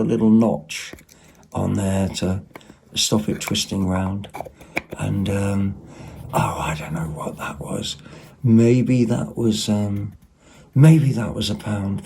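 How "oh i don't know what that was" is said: whispered aside. Real, not acted. Unsure aside to herself.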